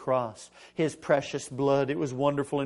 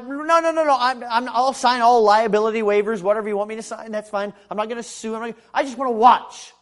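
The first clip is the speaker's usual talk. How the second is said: acted pleading; 6 semitones higher, 7 dB louder than usual. Acts out pleading with surgeon.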